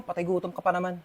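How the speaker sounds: ordinary talk, nothing marked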